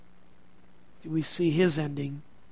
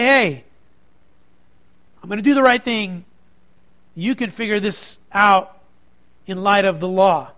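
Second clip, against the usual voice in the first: loud, decisive declaration; strong, firm; 6 dB louder than usual. Pretend. Preacher voices the governor standing up to the crowd, strong and firm. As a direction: stand your ground with raised volume. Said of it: "be strong and say".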